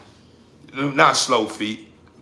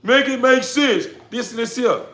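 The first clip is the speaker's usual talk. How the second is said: mocking the money crowd; 6 semitones higher, 6 dB louder than usual. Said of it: "the first thing they saying is".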